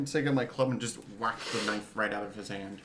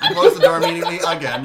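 laughing; 6 semitones higher, 16 dB louder than usual. Real, not acted. Player laughs at his own move.

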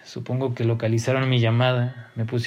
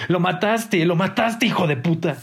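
higher-pitched, anguished narrated shout; accusing yell; 6 semitones higher than usual. A narrator's reading. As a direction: yell the accusation at the killer, voice raised high. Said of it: "empecé a gritarle".

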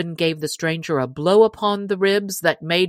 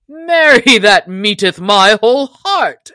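loud, raised-pitch storybook challenge; hearty cry; 4 semitones higher, 8 dB louder than usual. A narrator's reading. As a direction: cry it out boldly to an opponent. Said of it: "cried the stranger".

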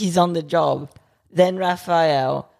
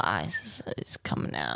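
whispering; 13 semitones lower, 12 dB quieter than usual. Acted. Dramatic hushed narration.

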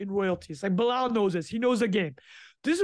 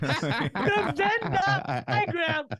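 laughing; 8 semitones higher, much slower than usual. Real, not acted. Laughing while talking.

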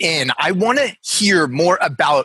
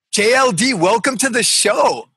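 hyped welcome shout; 7 semitones higher than usual. Acted. Host welcomes guest, shouted.

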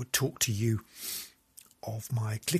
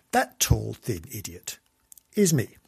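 ordinary talk

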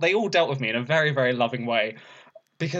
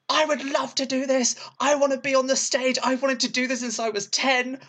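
much higher, over-eager excited pleading; eager shouting; 11 semitones higher than usual. Retold or imitated. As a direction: gush at her like a wildly keen fan. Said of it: "basically shouted at her".

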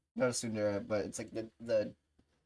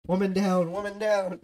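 higher, louder mock emergency call; mock alarm; 8 semitones higher, 6 dB louder than usual. Streamer announcing she broke her friend, mock-alarmed call. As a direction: call it out like a pretend casualty report.

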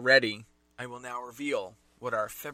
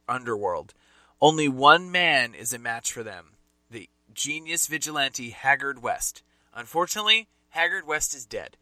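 dramatic book blurb read, louder; 5 dB louder than usual. Acted. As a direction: read it like a movie trailer.

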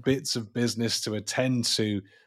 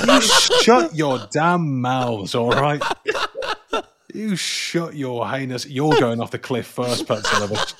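playful angry rant through laughter; mock anger; 2 semitones higher, 8 dB louder than usual. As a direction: pretend to be furious, half laughing. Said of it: "mock outrage at friends".

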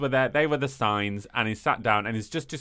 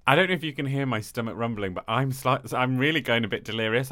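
ordinary talk, nothing marked

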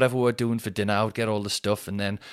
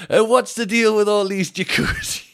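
high, loud mock complaining bloke; 11 semitones higher, 6 dB louder than usual. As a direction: moan like a spoiled middle-class man.

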